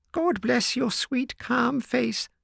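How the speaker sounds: put-on mildly sobbing old housekeeper; hysterical sob. A narrator's reading. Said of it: "she cried with an hysterical sob".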